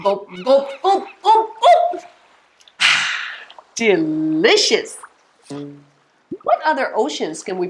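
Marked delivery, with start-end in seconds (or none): 0.0-2.0 pretend gulping. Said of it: "Drink the ocean". 2.8-3.3 airy "ahh" after gulping; satisfied sigh. Pretend. Satisfied sigh after pretend drink. 3.8-5.0 low, slow, drawn-out word; savouring. Pretend. Savouring the pretend drink.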